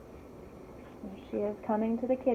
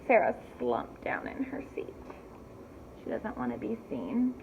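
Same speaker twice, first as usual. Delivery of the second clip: ordinary delivery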